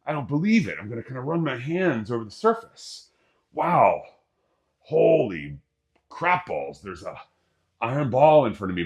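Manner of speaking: high, loud hammed-up awe; mock disbelief, touching the ball; amazed. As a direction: marvel out loud, overplayed. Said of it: "You'd be like".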